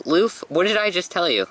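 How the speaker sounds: high, exasperated rebuke; exasperated scolding. Acted. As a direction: snap at him for slipping up. Companion scolding Luffy.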